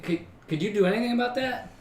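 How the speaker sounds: ordinary delivery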